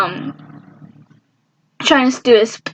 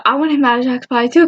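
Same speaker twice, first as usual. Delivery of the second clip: normal talking voice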